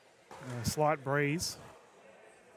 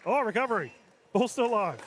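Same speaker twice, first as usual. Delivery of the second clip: high, loud rally call; excited play-by-play; 8 semitones higher, 6 dB louder than usual. Real, not acted. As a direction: shout the save as it happens. Live call of a rally save.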